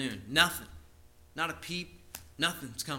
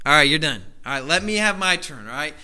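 louder, firm wrap-up; louder no-nonsense prophet; impatient cutoff; 10 dB louder than usual. Retold or imitated. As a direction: tell them time's up, firmly. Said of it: "Elijah's like".

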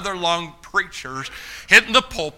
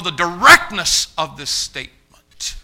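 normal talking voice

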